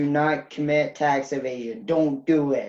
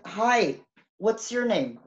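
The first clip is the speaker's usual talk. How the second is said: cashier voice; 7 semitones higher, slower than usual. Retold or imitated. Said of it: "imagine him working at a Target".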